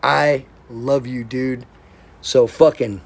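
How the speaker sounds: ordinary talk, nothing marked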